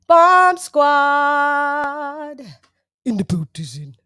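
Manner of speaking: loud, high call then dropping; hype announcer call. Acted. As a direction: announce the arrival like a DJ.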